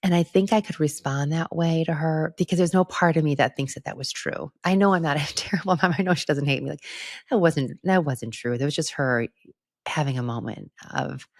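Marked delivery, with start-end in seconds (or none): none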